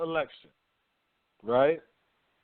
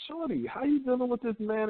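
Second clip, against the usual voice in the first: higher, puzzled challenge; incredulous question; 9 semitones higher than usual. Retold or imitated. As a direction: ask her like she's lost her mind. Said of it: "you looking at chicks like".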